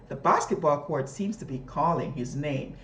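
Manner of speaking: put-on lively reading to children; storybook reading voice; expressive read aloud. Shown as a demonstration. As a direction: read it like a model reader for kids. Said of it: "listen to me as I read".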